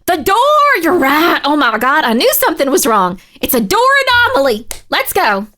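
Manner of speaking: loud, high-pitched burst of triumph; thrilled yelling at a discovery; excited shout. Real, not acted. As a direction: shout it out like you just cracked it. Spots the anomaly in the game.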